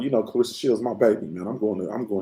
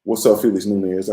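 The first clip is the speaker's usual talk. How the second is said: ordinary talk, nothing marked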